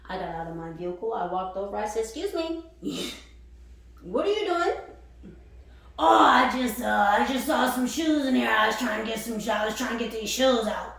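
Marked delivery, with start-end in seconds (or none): 2.0-4.7 high, sharp "excuse me", half-laughing; mock-polite challenge. Confronting man in her dumpster. 6.0-10.9 a hint of a flustered stranger, a bit louder; caught man's excuse. Voicing the homeless man's excuse.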